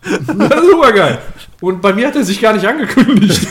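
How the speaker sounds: loud, high giggly delight; laughing excitedly. Real, not acted. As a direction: laugh through the words. Laughing after a burp.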